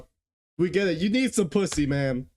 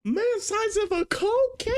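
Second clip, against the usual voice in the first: disbelief. Real, not acted. Reacting to quote.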